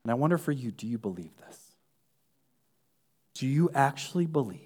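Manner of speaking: hushed, low pleading appeal to faith; soft altar call. Acted. Preacher softly asks the congregation if they truly believe, hushed. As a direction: draw them in quietly, almost confiding.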